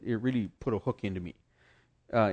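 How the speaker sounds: normal talking voice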